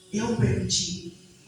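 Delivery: slow, deliberate Eu permiti with pauses; slow, paused words. Retold or imitated. God speaking slowly to a grieving mother. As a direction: lay each word down slowly and calmly. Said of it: "o Senhor falou pausadamente".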